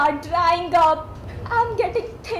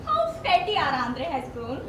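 ordinary delivery

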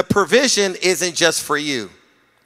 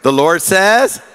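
loud congregation response; call and response; 4 semitones higher, 6 dB louder, slower than usual. Shown as a demonstration. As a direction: repeat it out loud. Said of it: "Say with".

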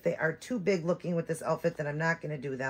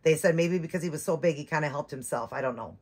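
normal talking voice

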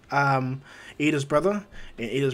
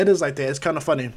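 usual speaking voice

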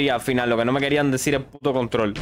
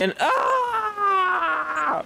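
startled cry; 14 semitones higher than usual. Real, not acted. Startled by a jump scare.

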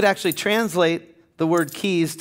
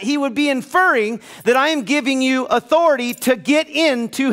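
full-force preaching; 9 semitones higher than usual. Acted. Pastor rallying his church, preaching at full force. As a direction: build to a fiery pulpit climax.

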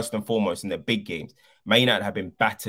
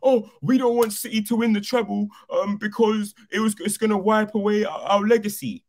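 high-pitched mimicked fretting; mocking fans; 11 semitones higher, 4 dB louder than usual. Fan mimicking those scared of City's treble, mocking high voice. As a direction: parody a worried fan in a high voice.

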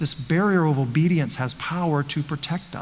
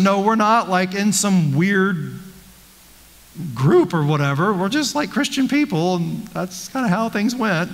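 higher-pitched retelling of the couple insisting they're normal; mock pleading couple; 5 semitones higher than usual. Pastor voicing the couple convincing the social worker, pitched up. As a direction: play the couple pleading their case, voice up high.

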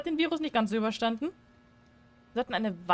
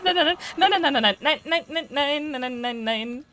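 rapid louder string of no's; frantic repeated nein; panicked no; 3 semitones higher, 4 dB louder than usual. Real, not acted. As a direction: beg the monster away. Fleeing monster in game.

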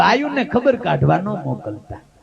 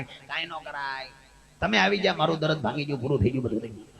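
hushed weak patient; 8 semitones lower, 11 dB quieter, faster than usual. Retold or imitated. Injured friend hushing it up.

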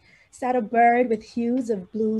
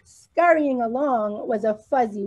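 usual speaking voice

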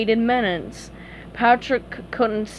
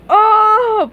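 high, loud yelp; frightened shout; 11 semitones higher, 9 dB louder than usual. Acted. Patrick sees the drawing held up, shouting. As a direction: yelp in sudden horror. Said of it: "Patrick shouted".

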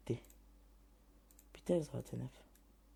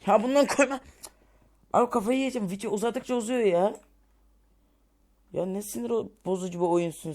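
higher, louder exasperated rant; frustrated outburst; 7 semitones higher, 6 dB louder, faster than usual. Real, not acted. Gamer ranting loudly that the video keeps growing. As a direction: vent loudly at the game.

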